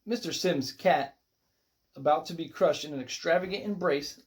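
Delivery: ordinary delivery, nothing marked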